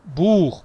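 "Buch" with a long oo before the ch; longer vowel. Shown as a demonstration. Teacher asks for a longer vowel, drawn-out "Buch". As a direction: lengthen the u in "Buch". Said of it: "más, un poco más largo".

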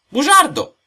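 high, very loud accusation; shouted outburst of outrage; angry insult. Acted. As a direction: hurl it at full voice, pitched high. Insult in acted quarrel.

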